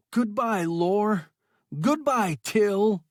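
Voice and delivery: loud, high triumphant farewell; shouted in triumph. A narrator's reading. Man bidding his tormentors farewell, triumphant shout. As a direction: fling the goodbyes out in triumph. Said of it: "he shouted in triumph".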